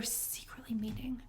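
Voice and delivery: hushed, slow conspiratorial whisper; slow gossiping whisper; secretive whisper. Pretend. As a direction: breathe it like a juicy secret. Conspiratorial aside about secret meetings.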